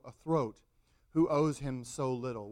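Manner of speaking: ordinary talk, nothing marked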